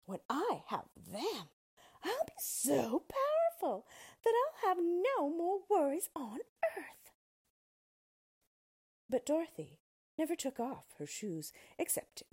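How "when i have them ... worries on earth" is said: high, loud cackling witch boast; gloating witch. Acted. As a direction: crow with greedy triumph.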